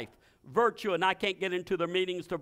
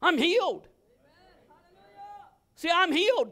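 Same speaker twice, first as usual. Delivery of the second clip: high, loud and defiant; defiant triumph; 7 semitones higher, 5 dB louder than usual. Real, not acted. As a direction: toss it back with triumph. Defiant reply to doubters.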